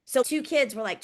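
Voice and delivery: usual speaking voice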